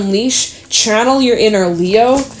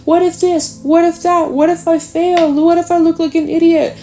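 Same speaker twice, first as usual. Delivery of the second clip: higher, fretful run of what-ifs; anxious what-ifs; 8 semitones higher than usual. Retold or imitated. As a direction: rattle off the worries like a nervous wreck. Mimicking anxious what-if thoughts.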